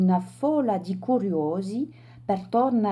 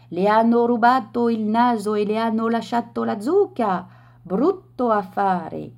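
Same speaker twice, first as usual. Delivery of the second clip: mocking jeer; 2 semitones higher, 4 dB louder than usual. A narrator's reading. Laughing crowd mocks the gentleman.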